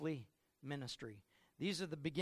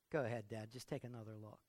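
low, soft gentle coaxing; calm son; 6 semitones lower, 6 dB quieter than usual. Acted. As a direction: quietly nudge dad to look again. Jesus gently, calmly.

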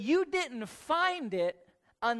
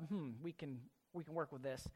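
mock translators musing; 7 semitones lower, 14 dB quieter, faster than usual. Translators' imagined thought.

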